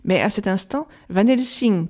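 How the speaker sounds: ordinary delivery, nothing marked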